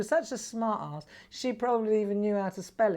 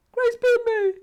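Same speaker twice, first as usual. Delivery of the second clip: put-on much higher whiny child's voice, louder; crying little boy; 9 dB louder than usual. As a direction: wail it like a hurt little boy. Son crying, mother imitates him.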